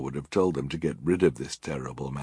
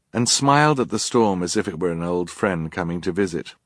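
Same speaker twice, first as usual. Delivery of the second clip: ordinary delivery, nothing marked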